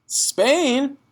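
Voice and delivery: high, loud disbelieving cry; a child's startled shriek at the news; child crying out in shock. Acted. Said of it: "Matilda cried".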